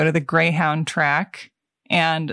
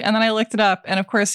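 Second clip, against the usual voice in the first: ordinary talk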